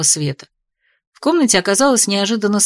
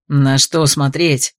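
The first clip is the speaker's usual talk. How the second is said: grumbling; 6 semitones lower, much slower than usual. A narrator's reading. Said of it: "проворчал Арчи".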